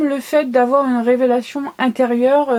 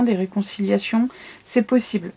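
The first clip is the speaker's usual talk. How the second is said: ordinary delivery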